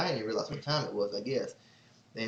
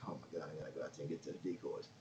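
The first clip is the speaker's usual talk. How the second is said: low, quiet, fast dread; muttering to himself; 8 semitones lower, 17 dB quieter, faster than usual. Retold or imitated. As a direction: mutter it low and quick to yourself. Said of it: "So I'm like".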